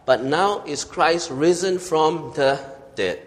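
slow, weighty proclamation with "But" leaned on; emphatic but. Shown as a demonstration. Preacher repeating Paul's declaration slowly, with weight. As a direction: stretch it out, landing on "now". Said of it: "is a very important emphatic "but".

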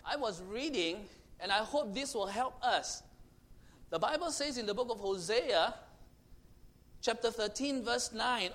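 ordinary delivery

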